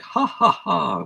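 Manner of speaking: slow, high mock "ha, ha, ha"; spaced-out imitation of a belly laugh; imitated laugh. Imitating Santa's laugh for a co-host, mock laugh. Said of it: "There's lots of, like".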